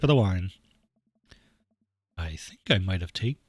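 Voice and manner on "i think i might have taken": low, soft attempt at Mary; wrong character voice. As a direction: try her in a lower, quieter register. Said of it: "that's not her voice".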